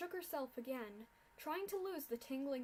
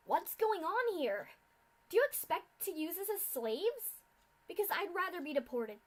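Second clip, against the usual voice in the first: loud defiant yell; defiant filly yelling; 6 semitones higher, 8 dB louder than usual. A narrator's reading. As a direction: shout back at the captor. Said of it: "Scootaloo yelled".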